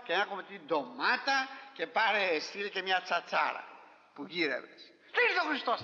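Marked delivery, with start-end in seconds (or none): 5.1-5.8 preaching exclamation. Real, not acted.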